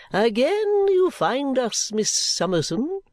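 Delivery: high, slow, grandiose swoops; affected grandeur, words drawn out; pompous dandy. A narrator's reading. Dandy greeting Miss Summerson, pompously gallant.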